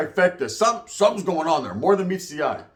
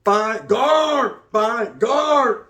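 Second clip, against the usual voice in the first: called-out catchphrase; 4 semitones higher, much slower than usual. Acted. Vlogger shouting his intro catchphrase. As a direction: bark it like a slogan.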